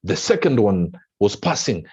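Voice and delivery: ordinary delivery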